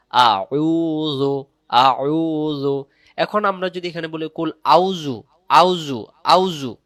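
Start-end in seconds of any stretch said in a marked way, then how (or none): 0.1-2.9 আউযু drawn out very slowly, syllable by syllable; slow spelled recitation. Shown as a demonstration. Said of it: "জাল পেশ জো". 4.3-6.8 আউযু with the ain dropped, au-ju; wrong pronunciation. Shown as a demonstration. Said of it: "আইন অক্ষরটা কি গেল? বাদ চলে গেল".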